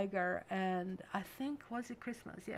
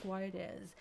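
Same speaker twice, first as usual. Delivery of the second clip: usual speaking voice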